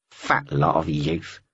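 low, slow crow muttering. Acted. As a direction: grumble it low under breath. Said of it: "she heard it mutter".

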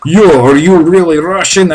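mock Jersey guy. Jersey guys quizzing the Russian, mocking tone. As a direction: needle him like a Jersey tough guy. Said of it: "They say".

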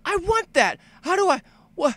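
very high, excited squeal; amazed player. Acted. Player seeing the title-screen blaster, high-pitched amazement. Voices player awed by rescuer's arrival.

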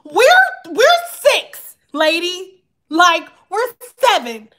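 put-on high, loud indignant kid voice; children arguing with the German teacher, high and indignant; kids protesting. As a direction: whine it back at the teacher like a fed-up kid. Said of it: "We was like".

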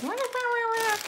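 high, held mock baby wail; wailing toddler imitation. Mom mimicking a toddler during a phone call, mock wailing. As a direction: whine "wah wah" like a fussy toddler. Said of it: "the toddler is like".

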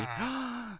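excited gasp. Real, not acted. Sees new dinosaur result.

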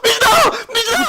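hammed-up sobbing calls; mock crying plea. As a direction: wail her name, half laughing. Pleading girlfriend after breakup. (울먹이며 매달리는 척)

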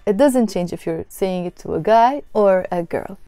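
normal talking voice

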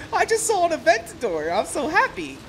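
high, giddy voice; giddy excitement. Retold or imitated. Telling his parents about the Aventador, high giddy gushing. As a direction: gush like a thrilled kid. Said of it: "And it's like".

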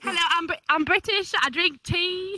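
teasing, hammed-up British accent; mock British accent. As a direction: put on a silly British voice. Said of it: "I'm very British".

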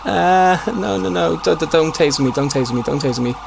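high, stammering panicked plea; panicked pleading. Real, not acted. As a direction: beg in real panic. Police about to tase him.

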